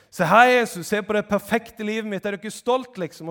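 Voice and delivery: high, loud, eager show-off voice; hammed-up bragging; mock boaster. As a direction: parody someone proudly presenting themselves. Mock boaster showing off to Jesus.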